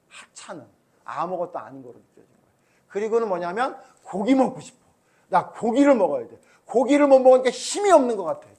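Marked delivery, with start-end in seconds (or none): 4.0-8.5 mock whiny complaint about wanting meat; a hint of petulant grumbling; complaining Israelites. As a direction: whine like hungry grumblers. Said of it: "이렇게 불평을 하고".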